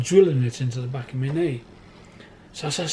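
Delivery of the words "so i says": ordinary delivery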